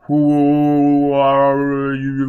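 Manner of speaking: very slow, loud and drawn out, like thunder; booming echoing question. A narrator's reading. Foyle's question roars at the burning man, slow booming echo. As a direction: stretch each word into a roaring echo.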